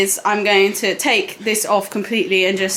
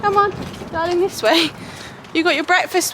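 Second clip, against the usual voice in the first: coaxing horse; 6 semitones higher than usual. Real, not acted. Leading horse to stable.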